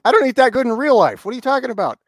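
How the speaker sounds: high, shouted comic disbelief; hammed-up indignant outburst; loud mock outrage. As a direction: exclaim high and loud, as if astounded. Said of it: "you're like".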